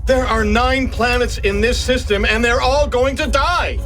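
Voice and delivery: high, loud cry of doom; horrified alarm. Acted. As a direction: raise the alarm in horror. Horror as the star is drained.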